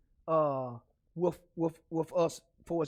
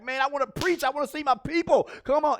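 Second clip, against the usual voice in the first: higher, pleading mock complaint; frustrated pastor; 7 semitones higher, 4 dB louder than usual. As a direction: voice a pastor itching to preach. Voicing pastors frustrated at not preaching.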